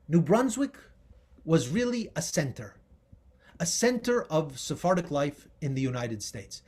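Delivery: normal talking voice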